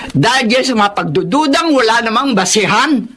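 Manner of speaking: usual speaking voice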